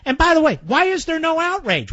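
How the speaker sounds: ordinary delivery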